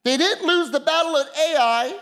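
rising, building preacher's cadence; preaching. Acted. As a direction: build it up from the pulpit.

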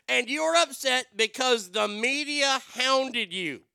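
loud, high, slow yelling; slow, loud, high-pitched tirade; furious rant. Real, not acted. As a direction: bellow each word out in outrage. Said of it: "you wanna talk about angry".

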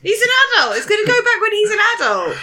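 high, loud indignant protest. Real, not acted. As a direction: protest in outraged disbelief.

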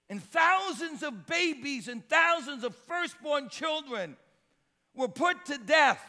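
high, loud pulpit cry; full-force preaching. Real, not acted. Preacher telling of children put to death for Baal, full-force preaching. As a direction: proclaim the horror to the congregation.

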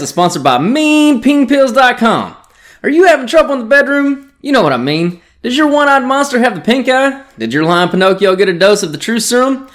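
loud, fast cheesy sponsor pitch; hammed-up ad read. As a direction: sell it like a sleazy infomercial. Reading the sponsor ad.

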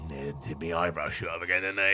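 low, sheepish Cockney rat. Acted. Rat admitting the eyebrows shot up, sheepish Cockney rat. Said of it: "as long as it's a Cockney rat".

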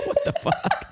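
high squeaky laughing disbelief; laughing squeak. Retold or imitated. Retelling the florist to the co-host, laughing squeal. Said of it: "I was like".

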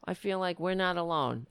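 ordinary talk, nothing marked

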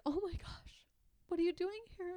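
retold gasp of surprise, pitched high; shocked. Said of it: "shocked to see me".

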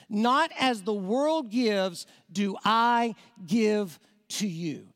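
slowed right down, each word weighed; slow emphatic reading. Shown as a demonstration. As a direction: read it slowly, letting every word land. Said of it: "Look at the next phrase".

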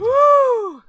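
high, loud "woo"; long high whoop after laughing; real amused holler. Real, not acted.

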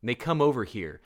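usual speaking voice

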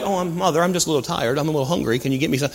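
soft, meek mock child asking mother; mildly complaining child. Child politely asking mother for food, meek and soft. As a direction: play down the hunger, quiet and polite. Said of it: "they're not like".

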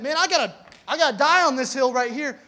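loud, high, worked-up insistence; insistent conviction. Acted. Stubborn believer refusing to give up a fight, loud and insistent. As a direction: proclaim it like a stubborn die-hard. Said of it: "if you're of the persuasion that".

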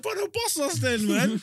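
squeaky high mock plea, slow; mock high-pitched begging to the security guard; high squeak. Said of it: "Man's like".

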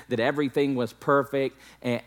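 ordinary delivery

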